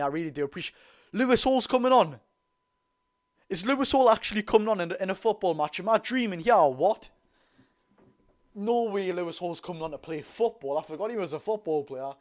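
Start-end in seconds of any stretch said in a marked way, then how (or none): 1.1-10.2 excited disbelief. Real, not acted. Sub comes on, stunned streamer.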